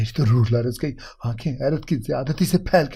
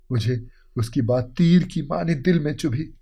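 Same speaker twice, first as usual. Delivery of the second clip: ordinary delivery, nothing marked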